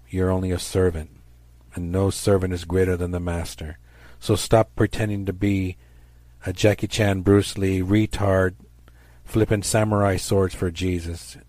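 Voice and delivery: ordinary talk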